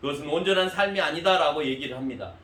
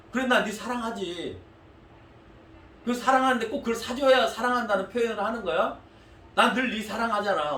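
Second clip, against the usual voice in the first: exasperated dad; 6 semitones higher, 3 dB louder than usual. Retold or imitated. Dad arguing with son.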